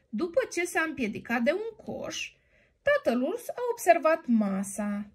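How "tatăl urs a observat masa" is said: ordinary delivery, nothing marked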